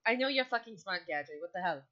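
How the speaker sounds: loud, fed-up mock telling-off; playful exasperated outburst; exasperated scolding. Captain fed up with Gadry.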